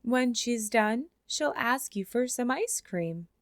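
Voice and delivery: usual speaking voice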